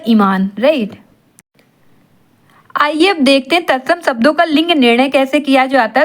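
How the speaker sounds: ordinary talk, nothing marked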